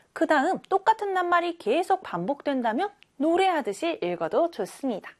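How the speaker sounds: usual speaking voice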